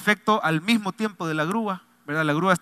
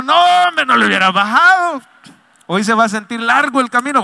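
loud, higher joking objection; playful protest; 5 semitones higher, 8 dB louder than usual. Retold or imitated. Pastor telling the driver not to turn the music down, loud and joking. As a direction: protest with a grin in the voice.

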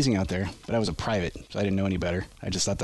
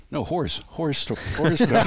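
higher, pointed correction of "horse story"; mocking echo; 6 semitones higher than usual. Retelling his morning correction with mock emphasis. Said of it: "and I said".

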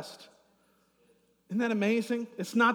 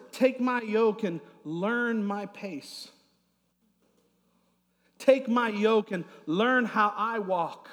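slow, stressed words of invitation; emphatic preaching; 3 semitones higher, 3 dB louder, slower than usual. Real, not acted. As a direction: lean into each word, slowly. Sermon building emphasis.

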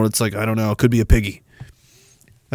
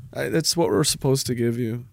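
quiet beaten mumble; 3 semitones lower, 10 dB quieter than usual. Acted.

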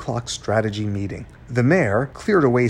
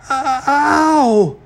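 loud, very high yelps; screeching in pain; 14 semitones higher, 8 dB louder, much slower than usual. A narrator's reading. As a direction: screech as if suddenly hurt. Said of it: "to his face and screeched".

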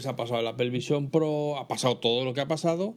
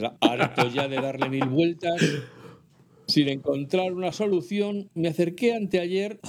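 slow, higher letter-reading voice; reading a letter aloud; 4 semitones higher, slower than usual. Acted. Host reading a listener's email on air, slow and higher. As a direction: read the email out slowly and clearly.

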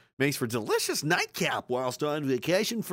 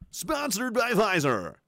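ad announcer; 7 semitones higher, slower than usual. Pretend. Mock ad tagline, higher.

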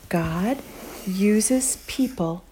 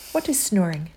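ordinary delivery

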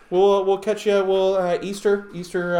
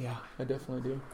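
quiet; 3 semitones lower, 13 dB quieter, much faster than usual. Retold or imitated. Follows whispered yes.

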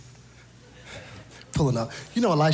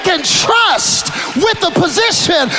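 shouted, high preaching cadence; full-force preaching; 12 dB louder than usual. Acted. Preacher shouting to the congregation, full-force preaching.

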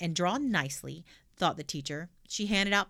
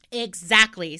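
put-on loud, bold little girl; cheeky girl voice; 10 dB louder, slower than usual. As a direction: blurt it out brashly. Pippi answers loudly.

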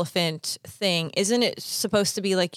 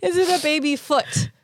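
laughing; 5 semitones higher than usual. Real, not acted. Giggling while guessing.

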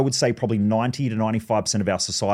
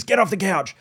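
higher-pitched owner's telling-off; mock scolding order; 7 semitones higher than usual. Owner to dog on the couch, mock scolding. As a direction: order the dog down, half-acted.

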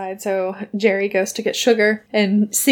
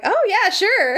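high, swooping put-on girl's voice; Daphne; 11 semitones higher, slower than usual. Daphne answering Sugar's beach invitation, high put-on voice. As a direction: agree in a high mock-feminine lilt. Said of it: "And Daphne's like".

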